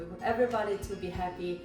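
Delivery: ordinary delivery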